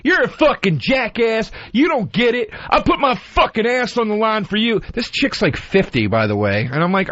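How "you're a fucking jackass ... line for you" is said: higher, shouted mock rant; higher mock scream at the comic; mock yell. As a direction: imitate the angry veteran yelling, slowed. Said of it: "starts screaming at me".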